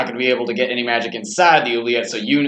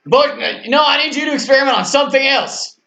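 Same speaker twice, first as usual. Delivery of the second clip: raised calling voice; 12 semitones higher, 4 dB louder than usual. Real, not acted. Calling Butch from other room.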